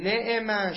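نعم pronounced ne'em, not na'am; kasra on both syllables. Shown as a demonstration. Teacher citing a variant to students, kasra on both syllables. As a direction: say نعم as ne'em, with two short e vowels. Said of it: "شهد به کسرتین".

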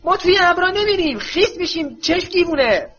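put-on young witch angrily refusing the clouds; angry shout. A narrator's reading. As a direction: protest hotly against the order. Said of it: "تانکس با عصبانیت فریاد زد".

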